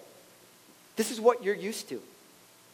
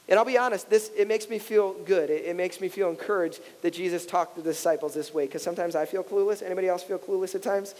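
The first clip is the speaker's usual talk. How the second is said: normal talking voice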